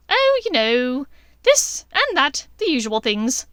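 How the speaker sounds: high, loud airy brush-off; dismissive witch. A narrator's reading. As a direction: wave it away like a mad witch. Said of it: "the insane witch said dismissively".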